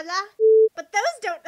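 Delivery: ordinary delivery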